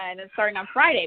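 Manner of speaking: ordinary talk, nothing marked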